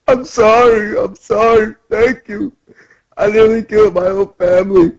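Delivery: high, tearful apology; hammed-up weepy townsman; mock crying. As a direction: wail it in a high, pretend sob. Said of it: "he's crying".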